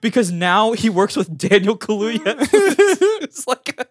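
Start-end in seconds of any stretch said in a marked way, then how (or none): 0.0-2.5 high-pitched laughter running through the words; can't get it out without laughing. Real, not acted. To a friend, amused at the Kaluuya irony, laughing.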